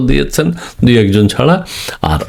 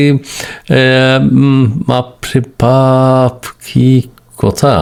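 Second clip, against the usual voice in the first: mock amazement; much slower than usual. Amazed at viewer answers.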